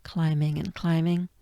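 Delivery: normal talking voice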